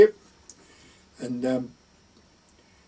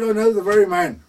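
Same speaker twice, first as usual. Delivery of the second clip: eager pilot; 5 semitones higher, 5 dB louder than usual. Retold or imitated. Pilot eagerly recommending his nephew.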